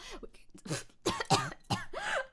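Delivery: sudden choking cough; coughing fit. Real, not acted. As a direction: cough hard on a fly. Swallowed a fly, coughing.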